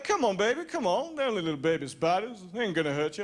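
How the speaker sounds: a lightly put-on lover-boy voice coaxing the girlfriend; put-on smooth talker. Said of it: "I don't talk like that".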